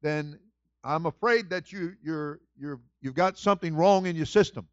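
loud, high, fired-up preacher; loud fired-up sermon; full-force preaching. Acted. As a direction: preach it hard to the congregation.

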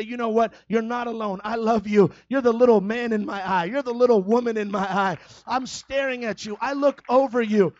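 loud, ringing preacher's cry; shouting sermon climax; full-force preaching. Acted. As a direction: preach it out to the whole room, full voice.

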